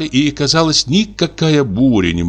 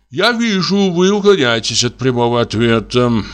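grumbled sullenly; 5 semitones higher than usual. A narrator's reading. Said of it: "проворчал угрюмо нотариус".

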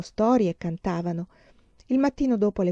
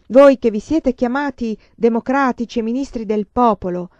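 fiery speech; 4 semitones higher, 6 dB louder than usual. Acted. Heroine warning the powers before the crowd, loud and fiery. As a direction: thunder a warning at the foreign powers.

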